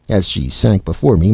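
plain reading voice

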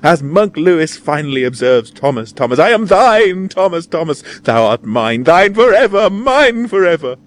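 high, loud melodramatic cries of love; theatrical heroine at fever pitch; rapturous declaration. A narrator's reading. Ecstatic lady claims Tom as hers.

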